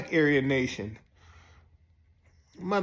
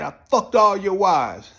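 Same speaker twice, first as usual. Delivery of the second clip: taunting; 4 semitones higher, 7 dB louder than usual. Retold or imitated. Taunting the inmates.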